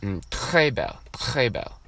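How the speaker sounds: a soft French accent on "très belle" and "très bon"; a French student showing off his French accent; French pronunciation demo. Shown as a demonstration. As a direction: roll the French words out carefully. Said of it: "you use it with some of the accents".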